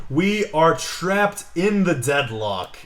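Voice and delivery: slow, loud, high mock-epic declaration; dramatic proclamation. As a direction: boom it out as a grand ominous announcement. Dramatic proclamation about the card.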